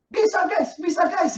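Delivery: thrilled he can do it; excited Peter. Pretend. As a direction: Peter bragging to friends, excited. Peter imagined excited walking.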